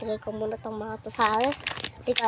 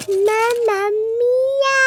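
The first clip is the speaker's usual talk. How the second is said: dramatic wail; 12 semitones higher, 11 dB louder, much slower than usual. Acted. Dramatic outcry over the mess.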